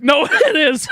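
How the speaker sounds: high, laughing through it; laughing cheeky retort. Retold or imitated. Told it's not funny, he laughs back at her. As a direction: laugh off the scolding.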